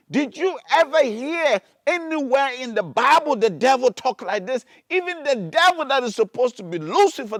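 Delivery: loud, high, hammering sermon; thundering warning from the pulpit; full-force preaching. Acted. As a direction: preach it at a shout, stretching key words. Rants at full force about God.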